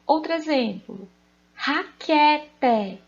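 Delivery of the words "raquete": "raquete" louder and higher, in separated syllables; syllable-by-syllable demonstration. Shown as a demonstration. Teacher giving pupils the example "raquete" to find the tonic syllable, sounded out. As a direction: sound out "raquete" as RA-QUE-TE, loud and deliberate. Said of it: "Qual a sílaba tônica?".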